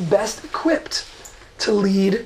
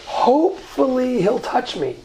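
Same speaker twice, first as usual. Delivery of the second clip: higher, stretched-out hopeful quote; 4 semitones higher than usual. Acted. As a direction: voice Jacob's wishful hope, lifting the pitch. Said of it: "it was a hopeful thing".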